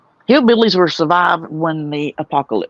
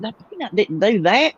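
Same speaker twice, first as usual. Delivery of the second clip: higher-pitched retold protest; indignant retort; 6 semitones higher than usual. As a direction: scoff on someone else's behalf, pitched up. Retold indignant defence of Peanut.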